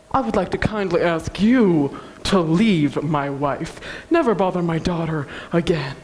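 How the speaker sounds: low, measured father's voice; acted low, firm dismissal; stern father. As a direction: drop low and order them out deliberately. Father sternly banishes his wife.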